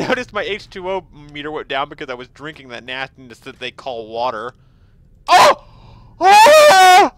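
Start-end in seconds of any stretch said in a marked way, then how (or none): 5.3-7.1 high, loud shriek of delight; surprised shriek. Real, not acted. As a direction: squeal at the sight of the prize. Finds Nuka-Cola.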